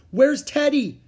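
high, loud worried question; crying out. A narrator's reading. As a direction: shout it in alarm. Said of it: "cried Jack".

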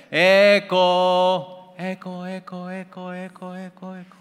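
high call that dies away, repeating; fading echo. Retold or imitated. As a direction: call it out high, then let each repeat fade to nothing. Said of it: "donde hay eco".

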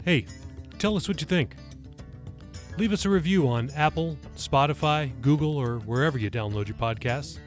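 usual speaking voice

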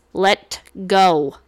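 loud angry snap; snapped. Acted. Succubus yanking her car door free, a loud angry snap. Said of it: "I snapped".

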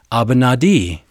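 "Abinadi" as ah-bee-nah-DEE; Semitic pronunciation. Shown as a demonstration. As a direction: say "Abinadi" with open ah vowels and a final DEE. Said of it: "that's how he heard his name".

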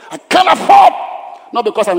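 sudden high, loud cry; earnest shout. Real, not acted. Pastor crying out to the church about his faith, loud shout. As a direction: burst out with it. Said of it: "pastor's earnest outburst".

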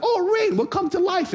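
tiny high bug voice; flies voice. Pretend. Flies waking after the rain, in a squeaky glee. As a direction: squeak like gleeful little flies. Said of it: "They're like".

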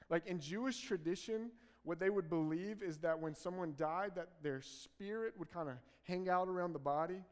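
ordinary talk

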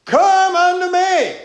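high, loud, slow summons; Wisdom calling out. Acted. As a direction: call it out like a voice from the hilltop. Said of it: "She's standing out and crying".

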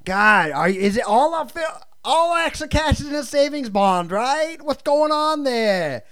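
hammed-up panic about the money. Continuing the stereotype bit.

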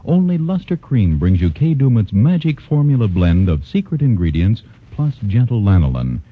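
ordinary talk

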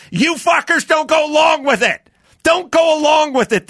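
angry shout at the audience. Real, not acted. Ranting podcast host yelling at listeners, furious shout. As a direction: yell it at them, fed up.

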